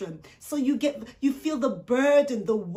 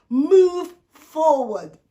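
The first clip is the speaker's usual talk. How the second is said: loud, very slow command; slow booming divine command; commanding God; 4 semitones higher, 8 dB louder, much slower than usual. Retold or imitated. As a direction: boom it out as God's order. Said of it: "And here comes God".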